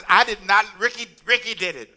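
hammed-up self-defence under accusation; defensive protest. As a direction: protest loudly and pin it on someone else. Acts out arguing against Grandmama's accusation.

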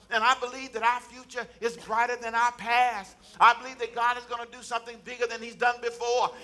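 fervent preacher's cadence, high and loud; preaching. Acted. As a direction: preach it to the whole church with fire. Preacher exhorting the congregation.

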